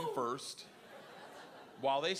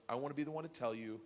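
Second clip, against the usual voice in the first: smug teacher; 5 semitones lower, 5 dB quieter than usual. Retold or imitated. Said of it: "smiling, and goes".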